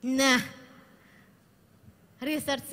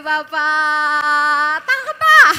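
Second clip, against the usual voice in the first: excited calling out; 14 dB louder, much slower than usual. Real, not acted. Trainer throwing a prize to the winner, excited shout. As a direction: call out loudly to the winner.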